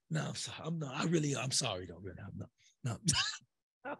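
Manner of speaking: low, soft half-hearted apology; mumbled fake sorry. Insincere wrongdoer to the man he hit, mumbling low. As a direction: mutter it like you don't mean it.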